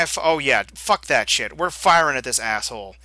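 usual speaking voice

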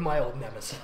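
ordinary talk, nothing marked

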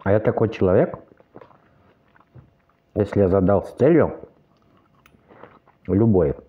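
chewing while talking; talking between bites of fruit; mouth full. Real, not acted. Seen 5: forkfuls of watermelon go into mouth; cheeks full, chewing throughout.

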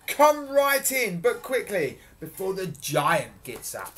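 high, loud, slow invitation, easing down; booming giantess. A narrator's reading. Giantess hurrying Jack inside, booming. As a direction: usher someone in grandly. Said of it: "boomed the giantess".

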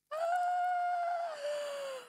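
high, drawn-out gasp of amazement; long shocked gasp. Real, not acted. Stunned by donation total.

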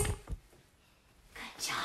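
soft whispered praise; whispering. Real, not acted. Praise after freeze game.

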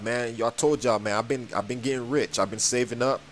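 normal talking voice

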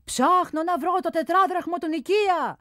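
high, loud exchange across a distance; calling back and forth. A narrator's reading. Shouted exchange between scaffold and ground, high and loud. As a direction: keep shouting up to be heard.

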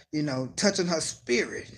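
normal talking voice

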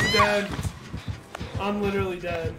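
loud panicked cry; yelling while being hunted; being chased. Real, not acted. Survivor caught during a chase.

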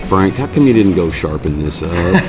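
normal talking voice

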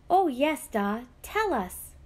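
high, bright-eyed begging to hear the story; chirpy plea. A narrator's reading. Little sister chirping at her da for the story. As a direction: chirp it out like an eager little girl. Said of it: "Ailish chirped".

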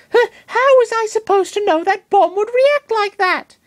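high-pitched defensive Pokemon voice; defensive Lucario. Acted. Lucario defends himself to Master Hand, high-pitched and defensive. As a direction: protest your innocence in a high voice. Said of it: "he asked in his defense".

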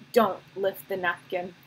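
usual speaking voice

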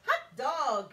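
loud, high excited cry; cheering kids. Acted. Class cheers the principal's departure, loud and high. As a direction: kids whooping with joy at being free. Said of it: "cried the class".

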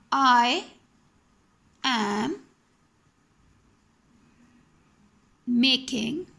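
each word slowly spaced apart. Shown as a demonstration. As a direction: dictate it word by word for the class. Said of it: "I would like to give the example here".

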